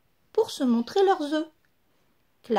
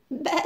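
animal cry; 3 dB quieter than usual. A narrator's reading. Animals straining to lay eggs.

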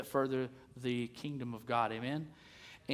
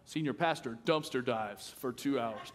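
mock headline; 4 semitones lower than usual. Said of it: "I just see the headline".

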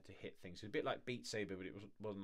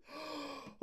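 sharp gasp; 6 dB quieter than usual. Real, not acted. Reacting to trailer.

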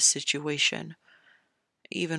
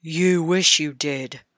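slow, louder grumble; grumbled; 6 dB louder, slower than usual. A narrator's reading. King Robert mocking his son's boast, slow grumble. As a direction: put the boy down with a scoff. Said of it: "King Robert grumbled to his son".

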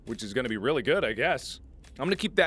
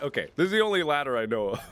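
ordinary talk, nothing marked

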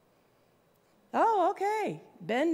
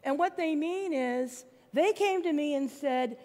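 ordinary talk, nothing marked

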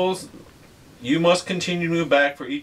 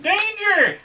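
dramatic danger cry. Acted. Playful echo of danger card.

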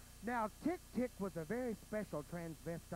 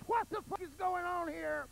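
higher, louder panicked cry; mock scream; 7 semitones higher, 3 dB louder than usual. Thrown to the ground, maiden shrieks in mock panic. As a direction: squeal it out in fake terror. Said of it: "Tick Tick screamed to the top of her lungs".

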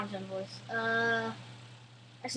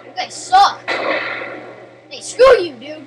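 frustrated yelling; 4 semitones higher, 10 dB louder than usual. Real, not acted. Yelling at game opponents.

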